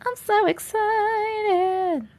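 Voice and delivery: stretched excited. Real, not acted. After cheering about merch.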